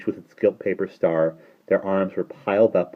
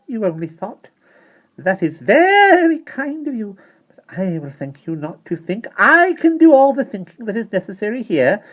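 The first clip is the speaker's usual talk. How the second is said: soft terrible voice; 9 dB louder than usual. A narrator's reading. Tiny mother scolding her daughter, icy and sarcastic. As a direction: belittle her with cold sarcasm. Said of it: "in her soft, terrible voice".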